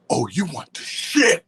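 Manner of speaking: drawn-out, rising movie quote; slow quote swooping up at the end; favorite movie line. Acted. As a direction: stretch it out like a spooky film character. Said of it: "my favorite shit is".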